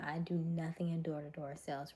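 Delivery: ordinary talk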